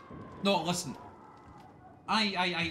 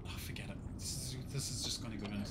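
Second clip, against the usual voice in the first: muttered give-up; 5 semitones lower, 9 dB quieter, faster than usual. Real, not acted. Giving up, muttered aside.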